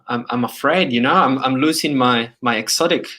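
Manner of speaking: usual speaking voice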